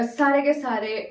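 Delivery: ordinary delivery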